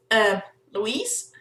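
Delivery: normal talking voice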